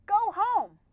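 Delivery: loud, high urgent order; urgent command. Shown as a demonstration. Pupil showing how a command can carry urgency, urgent. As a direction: exclaim it sharply, with urgency. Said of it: "they can carry a sense of urgency".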